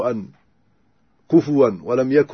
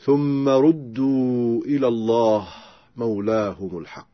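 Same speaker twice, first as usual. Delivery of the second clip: slow chanted tajwid recitation; Quran recitation; slower than usual. Acted. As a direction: chant the verse slowly and melodically. Recites Quran verse slowly. (تلاوة مجودة بطيئة)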